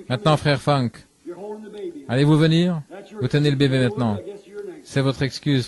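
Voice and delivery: ordinary delivery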